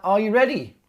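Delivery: big game-show host "are you ready"; TV presenter voice. Retold or imitated. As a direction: announce it like a telly host. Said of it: "people on the telly box and all that".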